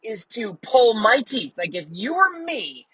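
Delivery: high-pitched disbelieving challenge; retold incredulous retort. Patient challenging the dentist's advice, high and incredulous. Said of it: "and then it said".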